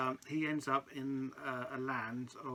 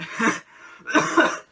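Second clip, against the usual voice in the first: loud high laugh; 11 semitones higher, 14 dB louder than usual. Real, not acted. Host laughs at guest's blunder.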